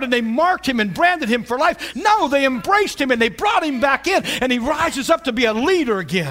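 preaching at full force. Acted. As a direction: lift the congregation with it.

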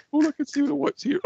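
breaking up in laughter mid-sentence; squeaky giggling through the words; laughing. Real, not acted. Co-host laughing at partner's eyes, laughing hard.

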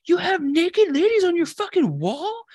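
put-on high, slow, shocked girly voice; girl discovering his wrestler posters, high and shocked; mock shocked girl. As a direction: imitate the girl gasping at the posters.

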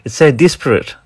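put-on playful New Zealand accent. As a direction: tease in a New Zealand accent, vowel turned so "disparate" sounds like "desperate". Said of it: "sounds like a New Zealand person saying the word desperate".

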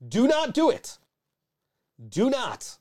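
half-joking loud plea to the audience; emphatic shouted warning. Real, not acted. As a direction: warn them off with full force.

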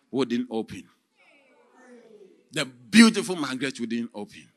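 normal talking voice

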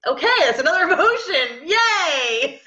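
amused parent cheering her daughter's growl, laughing. Real, not acted. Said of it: "And I'm like".

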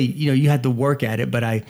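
ordinary talk

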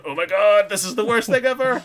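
high, loud hammed-up wail of despair; mock panic. Staff panicking over the till being short, high loud mock wail. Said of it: "they're all going".

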